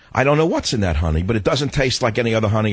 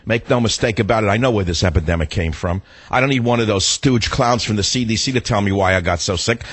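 normal talking voice

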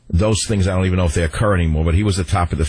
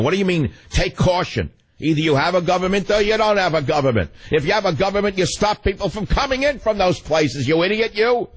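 much higher, indignant tirade; angry rant; 8 semitones higher than usual. Real, not acted. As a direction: rail at the government, pitch climbing. Host rants at government inaction.

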